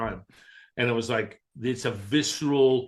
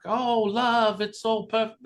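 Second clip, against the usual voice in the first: much higher, sugary mock sincerity; mocking gush; 11 semitones higher, slower than usual. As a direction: parody people who call love the whole answer. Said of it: "a lot of people want that to be the hallmark".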